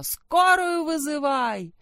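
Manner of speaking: high, slow, urgent shout; panicked shout. Acted. Mother shouts to call an ambulance. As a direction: yell for an ambulance. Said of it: "Мать закричала".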